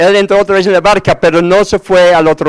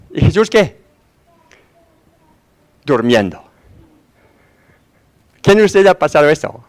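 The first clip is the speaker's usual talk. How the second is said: ordinary delivery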